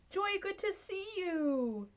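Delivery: high, slow, sing-song hello; a high drawn-out sweet hello to Joy; cooing greeting. Real, not acted. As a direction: coo it up high like greeting a pet.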